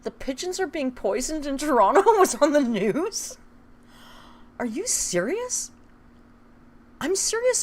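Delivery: high, loud disbelieving shock; can't believe what you just read; shocked disbelief. Real, not acted. Streamer reacts to chat news, shocked and loud.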